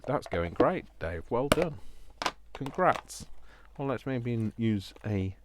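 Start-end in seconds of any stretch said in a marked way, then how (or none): 0.1-3.2 sarcastic praise. Acted. Teasing Dave about the mess.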